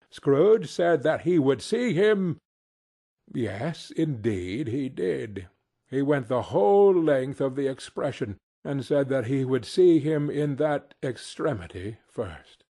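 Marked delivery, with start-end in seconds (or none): none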